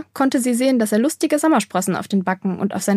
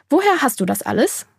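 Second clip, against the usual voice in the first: mildly stern questioning, pitched up; 5 semitones higher than usual. A narrator's reading. Maya questions the boy about the things, stern. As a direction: demand an explanation like a strict mother. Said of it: "fragte sie streng".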